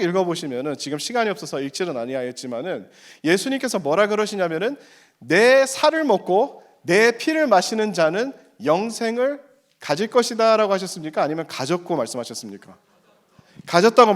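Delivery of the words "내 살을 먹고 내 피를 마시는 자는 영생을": slow, high, weighty scripture quote; emphatic slow quote. Acted. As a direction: lean on each word slowly. Preacher quoting Jesus emphatically.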